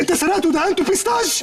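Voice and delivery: high-pitched disbelieving accusation; shocked accusation. Acted. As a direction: point the finger, scandalised. Accusing friend of theft.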